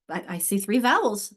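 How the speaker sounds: usual speaking voice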